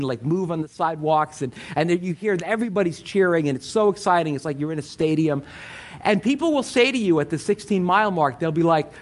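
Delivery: ordinary talk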